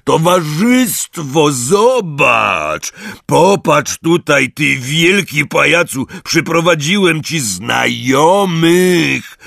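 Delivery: high, loud, drawn-out calling; shouting. A narrator's reading. Hagrid urging the giant to look, slow loud shout. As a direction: shout slowly to get his attention. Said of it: "krzyknął Hagrid".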